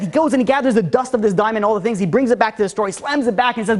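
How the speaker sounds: normal talking voice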